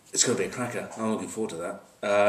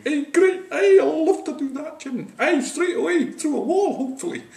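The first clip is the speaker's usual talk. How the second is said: very high, loud, eager Scottish voice; eager Scots; 8 dB louder than usual. Acted. Scottish players answering their coach, overeager high Scottish accent. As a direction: squeal with keenness in a Scottish accent. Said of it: "The Scots, of course, were going".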